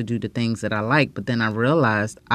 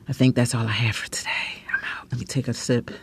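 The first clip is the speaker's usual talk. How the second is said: whisper; 5 dB quieter, faster than usual. Real, not acted. Signing off, whispered aside.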